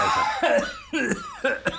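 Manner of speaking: very high falling cackle; shrill cackle. Acted. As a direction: cackle wildly. Spider cackles.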